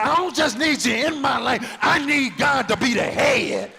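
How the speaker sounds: loud, high preached shout; preaching shout. Real, not acted. Preacher shouting to the church that God must lead, full shout. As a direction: proclaim it at full force.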